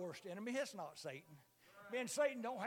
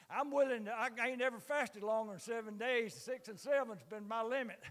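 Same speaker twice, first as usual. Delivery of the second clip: ordinary talk, nothing marked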